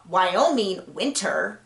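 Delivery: "Wyoming winter" slow and carefully separated; "Wyoming winter" said with slow, careful articulation; very deliberate. Shown as a demonstration. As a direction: sound out "Wyoming winter" word by word to get past the tongue twister.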